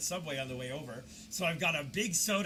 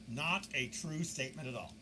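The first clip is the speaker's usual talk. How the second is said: usual speaking voice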